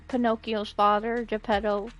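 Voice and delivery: ordinary delivery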